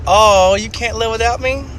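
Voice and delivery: high, put-on girlfriend voice. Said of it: "And she goes".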